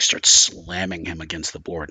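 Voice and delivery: ordinary delivery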